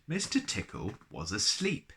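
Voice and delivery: ordinary delivery, nothing marked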